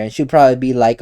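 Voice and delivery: ordinary talk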